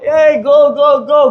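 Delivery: mocking devil egging you on; devil's taunt. Preacher voicing the devil urging go go go. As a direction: goad them on like the enemy. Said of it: "Ganun lang yan siya, yung kaaway".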